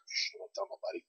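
conspiratorial whisper. Pretend. Telling viewers to keep work Facebook secret, whispered. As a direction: hush it like sharing a secret.

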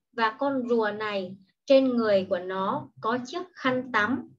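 normal talking voice

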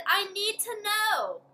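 high, slow pleading. Acted. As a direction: beg to know. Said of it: "Kia pleaded".

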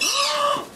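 very high sharp intake of breath; big gasp. Real, not acted. Seeing the holiday packaging, a very high awed gasp. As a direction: gasp in awe.

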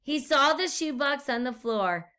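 ordinary talk, nothing marked

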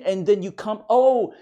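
ordinary delivery

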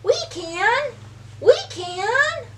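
high, bright eager call; eager answer. Acted. Fish answer the stuck duck, eager high call. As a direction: cheer it out as helpers.

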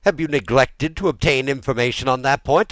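high, loud rebuke; incredulous royal scolding; indignant. A narrator's reading. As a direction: demand it in angry disbelief. King rebukes ignorant minister.

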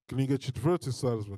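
ordinary talk, nothing marked

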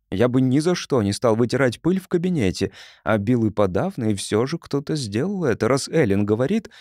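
plain reading voice

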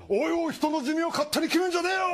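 comic turtle telling him off; mock angry comeback. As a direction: bark it back as the offended turtle.

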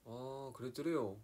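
slow, drawn-out Gangwon lilt; Gangwon dialect. Shown as a demonstration. Showing fans Gangwon speech, slow Gangwon accent. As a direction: stretch it out with Gangwon intonation. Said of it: "막 이게 강원도".

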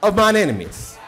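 high, loud whooping preacher cadence; sing-shout each line up to the congregation; preaching at full force. Real, not acted.

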